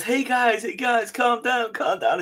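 high-pitched mock Southern US promo voice, slow; imitating wrestler promo. Quoting Kofi's promo, a high, slow mock Southern US voice. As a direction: ham up a Southern US wrestler calming the crowd. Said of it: "the bit where he goes".

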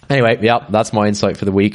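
normal talking voice

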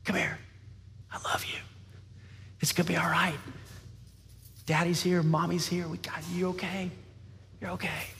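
hushed comforting parent; still small voice. Acted. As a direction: soothe a scared child, softly and unhurried. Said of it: "It's in that still small voice".

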